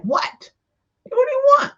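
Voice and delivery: high, loud annoyed snap; God's high annoyed retort to a grieving mother. Retold or imitated. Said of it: "You know, annoyed".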